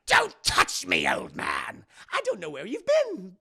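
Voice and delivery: hammed-up furious outburst; enraged Joker. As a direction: lose it, biting out each word. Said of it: "loses it".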